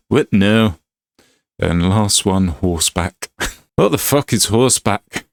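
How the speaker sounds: usual speaking voice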